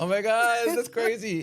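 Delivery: playfully astonished, pitched high; mock amazement. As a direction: gush as if truly fooled.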